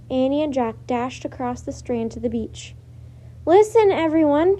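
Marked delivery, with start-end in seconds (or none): 3.5-4.6 loud, high call to a crowd; shouted. A narrator's reading. Girl shouting a warning to the crowd on the beach, loud. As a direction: call out to grab everyone's attention. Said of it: "shouted Annie".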